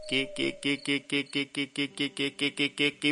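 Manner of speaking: rapid monotone "ki" on repeat; imitated repetitive noise. Storyteller mimicking a noise, monotone staccato repetition. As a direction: chant out an even string of identical ki sounds.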